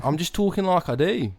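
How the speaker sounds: mock-innocent Aussie; Australian accent. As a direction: deny it in a put-on Australian accent. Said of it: "you've sustained this accent".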